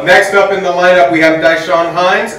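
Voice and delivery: loud, hammed-up emcee introduction; mock announcer. Introducing the next comic at the roast, announcer voice. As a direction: announce the next act like a show host.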